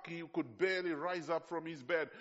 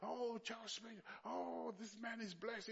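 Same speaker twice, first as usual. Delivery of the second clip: higher, softer awed gushing; admiring onlookers; 6 semitones higher, 9 dB quieter than usual. Retold or imitated. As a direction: gush like a starstruck churchgoer. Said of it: "and they were like".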